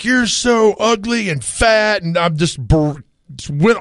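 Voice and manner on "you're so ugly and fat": high, slow taunting jeer; mock heckler. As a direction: drag out the insult like a heckler. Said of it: "And they're like".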